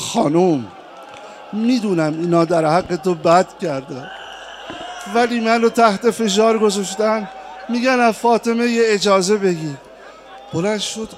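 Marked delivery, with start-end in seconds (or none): 0.0-9.8 a louder, urgent plea to his wife; pleading husband. Acted. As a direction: voice him pressing her for permission.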